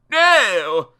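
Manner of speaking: loud, high cry of horror; theatrical princely scream. A narrator's reading. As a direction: yell it as a horrified prince. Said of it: "The prince screamed".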